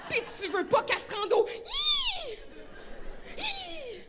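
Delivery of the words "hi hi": high startled gasp; sharp gasp. Retold or imitated. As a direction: gasp like a scared mom. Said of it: "quand elle soupire".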